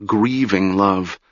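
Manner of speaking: usual speaking voice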